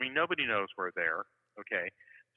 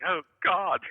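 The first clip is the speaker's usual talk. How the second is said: higher, louder mock exclamation of horror; mock dismay; 8 semitones higher, 5 dB louder than usual. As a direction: imitate a horrified onlooker's exclamation. Surgeon's wife reacts in disgust.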